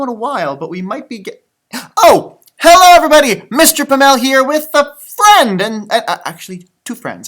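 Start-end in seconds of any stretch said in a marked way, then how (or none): 2.0-5.6 loud and high cheerful greeting; excited greeting. Real, not acted. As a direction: burst in with a big cheerful hello. Greeting kids excitedly on camera.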